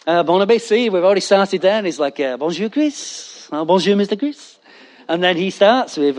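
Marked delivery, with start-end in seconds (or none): 2.3-4.6 put-on French accent. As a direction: greet him like a hammed-up Frenchman. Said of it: "he's French Chris".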